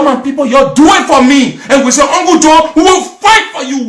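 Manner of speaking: high, loud, worked-up testimony; very emotional. Real, not acted. Recounting to the people how they fought for Uncle Joe, very emotional. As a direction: pour it out, high and forceful. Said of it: "I became very emotional".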